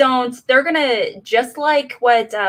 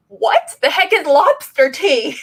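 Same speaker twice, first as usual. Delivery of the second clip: slow, higher, drawn-out bewilderment; baffled disbelief; 4 semitones higher than usual. Real, not acted. Viewer questioning the odd lobster tea line, baffled. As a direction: stretch out the question in comic disbelief.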